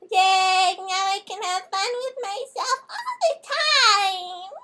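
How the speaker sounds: put-on high, squeaky kid voice; little girl voice. Little girl skipping off happily, high childish voice. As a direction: chirp it like a five-year-old.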